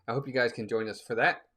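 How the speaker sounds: ordinary talk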